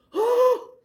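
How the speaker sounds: a panicked gasp of a word; freaking out. Retold or imitated. Preacher acting out servant Gehazi seeing the army, panicked. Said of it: "he's freaking out".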